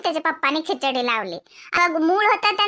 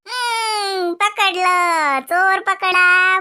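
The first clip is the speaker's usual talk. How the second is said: slow, drawn-out teasing; चिडवणे; 3 dB louder, much slower than usual. Acted. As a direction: stretch the words out in mock triumph. Teasing the mother caught out by son. (लांबवून चिडवत)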